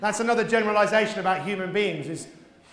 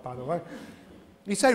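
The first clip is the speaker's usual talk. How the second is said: normal talking voice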